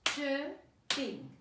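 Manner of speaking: CHIRPING in two separate beats, CHIR - PING; clapped syllables. Shown as a demonstration. Teacher clapping a word with children, CHIRPING syllable by syllable. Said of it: "Let's clap it".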